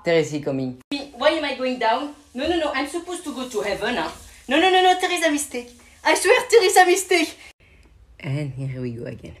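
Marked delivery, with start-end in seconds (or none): 1.1-7.4 high, fast pleading squeaky voice; frantic cartoon pleading; panicked high voice. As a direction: beg not to go down.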